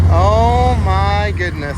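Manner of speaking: loud, thrilled exclamation after a laugh; awestruck outburst. Real, not acted. Vlogger marvels at the other side, loud excited awe. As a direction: burst out in delighted awe.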